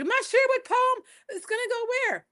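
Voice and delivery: high laughing mock panic; laughing worry. Poet recalling fretting to mentor, high laughing voice. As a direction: fret it out with a laugh, fast. Retelling her own anxious words.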